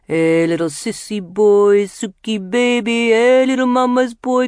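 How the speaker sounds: put-on high, loud, babyish jeering voice; singsong mockery. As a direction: coo it like mocking a child. Jamil mocks crying Boutros.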